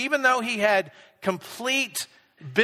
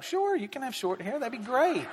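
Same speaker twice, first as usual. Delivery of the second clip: softer, higher, breezy mock-casual yes; breezy agreement; 4 semitones higher, 6 dB quieter than usual. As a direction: toss off an easygoing yes with a laugh. Agreeing to daughter's haircut request.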